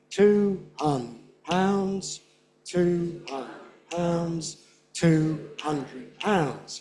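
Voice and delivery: slow, each of "two hundred pounds" beat out; beat on every word. Shown as a demonstration. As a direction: stamp every stress. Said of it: "content words".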